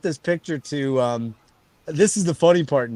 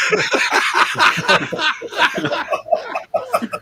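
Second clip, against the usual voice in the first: big laugh. Real, not acted. Friend recalls a silly Santa Claus guess, laughing hard. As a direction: crack up hard.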